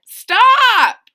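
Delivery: high, very loud shouted command; sudden full-voiced storybook shout; parents' shout. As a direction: yell it out to halt a child. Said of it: "but her parents said".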